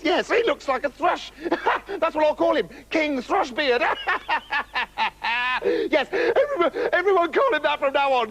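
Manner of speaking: high, gleeful princess jeering; Beryl, hysterical mockery. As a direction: jeer through bubbling giggles.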